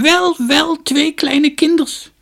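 put-on high, loud, creaky old woman's voice; shrill-pitched storybook crone, full voice. A narrator's reading. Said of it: "riep een krakerige stem".

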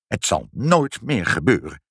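ordinary delivery, nothing marked

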